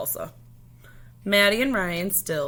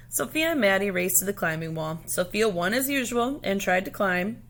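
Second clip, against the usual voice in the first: ordinary delivery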